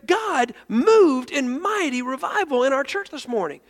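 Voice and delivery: loud, high, building excitement; breathless news of a miracle, pitched high; excited retelling. Retold or imitated. Pastor phoning a friend about revival in his church, excited.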